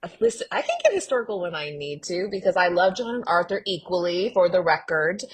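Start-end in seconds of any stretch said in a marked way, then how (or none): none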